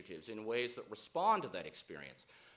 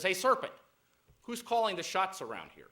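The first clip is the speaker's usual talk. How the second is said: loud, high-pitched call throughout; bossy lion's challenge; 7 semitones higher, 7 dB louder than usual. Acted. Lion demanding recognition from the serpent, bossy loud challenge. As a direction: hail the serpent like a cocky king.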